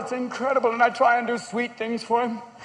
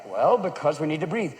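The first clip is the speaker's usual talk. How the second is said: low, explaining dad voice; patient parent; 9 semitones lower, 3 dB quieter than usual. Retold or imitated. Dad explaining to small son, low patient voice. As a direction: answer low, holding onto patience. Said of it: "when they ask you about everything".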